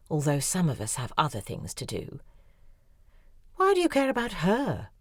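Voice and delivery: ordinary delivery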